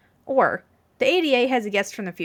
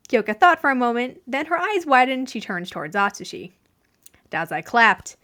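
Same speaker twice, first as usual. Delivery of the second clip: plain reading voice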